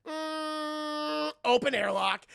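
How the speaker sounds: high held hum, then loud choice; mock trance decision; entranced. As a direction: hum dreamily, then announce it. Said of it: "snaps out of his trance".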